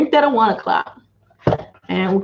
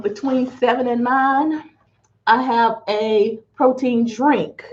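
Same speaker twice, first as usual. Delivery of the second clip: usual speaking voice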